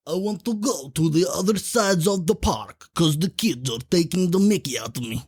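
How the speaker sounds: playful fake Russian accent, sung up high; a Russian accent like Borat, half sung; mock Borat accent. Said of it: "you're going a bit Borat there".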